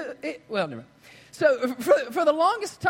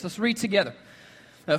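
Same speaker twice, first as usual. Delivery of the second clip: normal talking voice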